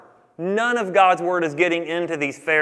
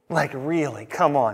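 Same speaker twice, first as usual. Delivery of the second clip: mock exasperation; 3 semitones lower than usual. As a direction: play Jesus fed up with them, mildly. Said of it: "And Jesus goes".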